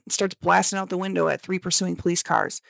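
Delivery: normal talking voice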